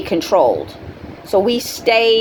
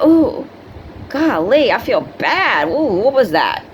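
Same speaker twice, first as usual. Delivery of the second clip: high, startled "ooh" fading to unease; dazed dismay; 4 semitones higher than usual. Retold or imitated. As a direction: act suddenly drained and puzzled. Feeling drained after vampire encounter.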